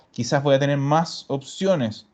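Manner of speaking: ordinary talk, nothing marked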